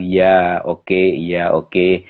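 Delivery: low, submissive retold agreement; imitating humble nods of assent; meek acquiescence. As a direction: give in meekly, pitch held low. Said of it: "Saya diam, saya nunduk".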